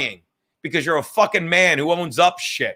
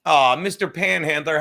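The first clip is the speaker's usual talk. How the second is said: ordinary delivery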